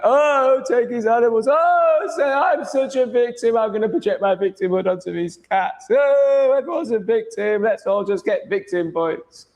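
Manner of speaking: high, nasal-free sing-song parody of a self-pitying critic; mocking whiny viewer. Streamer parodies a viewer who calls him cruel, high whiny mock. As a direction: whine it up high like a smug victim. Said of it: "without you being like".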